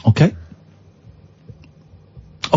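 ordinary delivery

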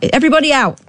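high mock call to evacuate; mock alarm call. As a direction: call everyone out, high and hammed-up. Imagined alarm call in bed crisis.

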